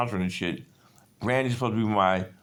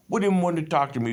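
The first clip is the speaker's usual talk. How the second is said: Jamaican patois; 9 semitones higher, faster than usual. Retold or imitated. Wife questioning her husband about the sergeant, in Jamaican patois. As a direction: fire it back fast in Jamaican patois.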